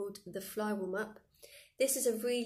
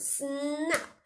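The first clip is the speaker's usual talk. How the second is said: higher, sharp vicious snap; 5 semitones higher, 3 dB louder than usual. Shown as a demonstration. Teacher shows the class a vicious snap. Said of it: "be really vicious and really go".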